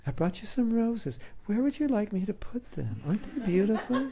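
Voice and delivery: high, syrupy lover's voice breaking into a laugh; mock sweet husband. Husband offering roses to his wife, hammed-up and sugary. Husband acting out fake romance.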